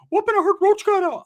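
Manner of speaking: very high, loud cartoon squeak; Ricky. Inmate passing on news to his friend, squeaky Mickey Mouse voice. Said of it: "that high Mickey Mouse voice".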